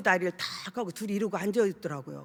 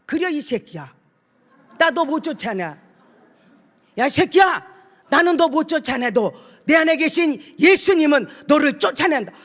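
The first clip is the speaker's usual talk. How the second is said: rising, louder shout at the demon; fierce rebuke; 4 semitones higher, 8 dB louder than usual. Retold or imitated. Preacher rebuking the demon in the youth, retold angry shout. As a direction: grab him and rebuke the spirit hard.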